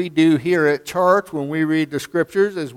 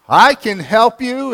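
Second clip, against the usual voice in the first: a lightly voiced heavenly answer, higher and louder; 8 semitones higher, 6 dB louder than usual. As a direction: answer from above, booming a little. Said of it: "a voice from heaven sounded".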